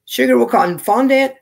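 ordinary delivery

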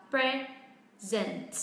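"present" broken slowly as PRE-zent, first syllable leading; stress on first syllable. Shown as a demonstration. Teacher shows the noun form, first-syllable stress on "present". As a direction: stretch "present" into two parts, stress on PRE. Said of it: "trọng âm sẽ rơi vào âm tiết thứ nhất".

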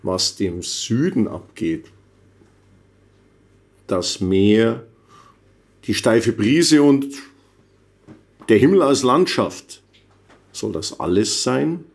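spare, measured poem reading with long pauses; poem recital. Acted. Poet listing what the South lacks, slow deadpan recital.

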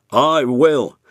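loud, firm promise; big engine Gordon. A narrator's reading. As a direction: warn him he'll pay. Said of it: "said Gordon".

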